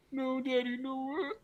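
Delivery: put-on high, soft little-kid plea; tiny high whimper of a son to his dad; child's voice. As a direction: mimic a crying five-year-old. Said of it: "son's reply in retold scene".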